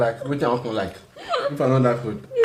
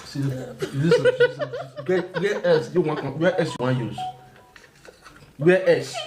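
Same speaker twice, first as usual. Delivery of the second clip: laughing; much slower than usual. Real, not acted. Laughing at the prank.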